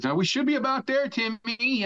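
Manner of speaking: high-pitched captain calling to his crewman; retold calling out. Captain to crewman while keeping count, calling out. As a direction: call across the deck, imitating the captain.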